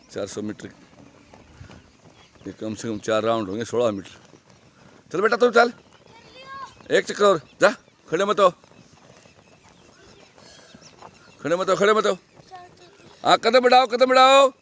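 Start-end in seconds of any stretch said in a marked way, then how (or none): 5.1-14.5 shouted urging. Real, not acted. Coach urging runner on track.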